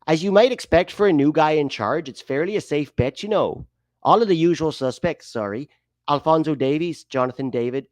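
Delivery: hammed-up Canadian accent drifting towards Irish; put-on Canadian accent. Host reading the roster news, mock Canadian accent. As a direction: lilt it like an apologetic Canadian. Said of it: "Try to be more Canadian".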